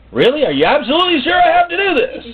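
very high, loud disbelieving plea; beg, voice shooting up; pleading whine. Retold or imitated. Jesus pleads with the Father, high and loud in disbelief.

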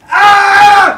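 sudden loud yell of fright; startled shout. Real, not acted. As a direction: jolt and yell out. Sudden scare in garage.

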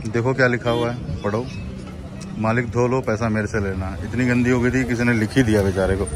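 normal talking voice

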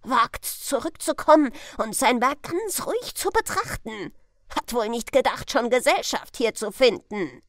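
outraged onlooker; loud scornful bystander. Acted. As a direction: sneer it out for all to hear.

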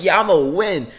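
cocky victory shout; retold boast. Retold boast during a competition.

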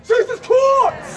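high, drawn-out scream; sudden stretched high cry. Shown as a demonstration. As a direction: scream the name long and high. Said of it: "the scream stayed".

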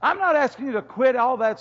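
God's reassurance. Acted. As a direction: lift it high, easy promise. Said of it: "Then he says".

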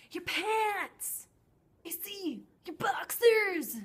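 high, loud mocking yell; mocking shout. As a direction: yell it and point, hammed up. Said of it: "What is that?".

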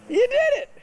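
high, loud "you did it!"; cheering praise. Real, not acted. Praising child after a push.

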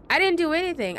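high-pitched retold protest; acting out wounded innocence; indignant protest. Retells protesting against being insulted.

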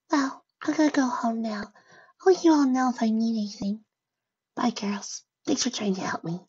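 timid high-pitched farewell; meek, dejected pony. Acted. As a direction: say goodbye small and high, ending near a whisper. Sad Fluttershy says goodbye and leaves.

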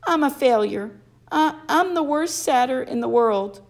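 high, miserable satyr voice; moaning. A narrator's reading. Satyr blames himself for the loss, high moaning wail. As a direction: wail it out self-pityingly. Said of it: "he moaned".

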